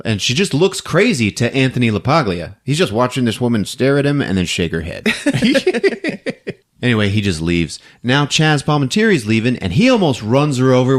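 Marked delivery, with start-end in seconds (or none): none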